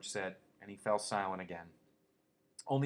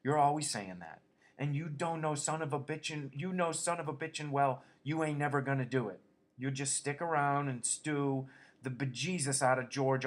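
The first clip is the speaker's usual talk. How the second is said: sharp scolding; 5 semitones higher, 4 dB louder than usual. A narrator's reading. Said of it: "she said sharply".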